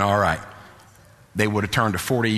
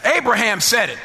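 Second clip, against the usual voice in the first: high, sustained, shouted sermon delivery; full-force preaching; 13 semitones higher than usual. Real, not acted. Preacher rousing the congregation to confidence, fervent high-pitched preaching. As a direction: ring it out in rousing, driving phrases.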